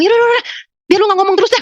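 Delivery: high-pitched, fed-up dismissal; exasperated brush-off. Retold or imitated. Woman brushing off the nagging voice, exasperated. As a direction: wave it away in a high, impatient voice.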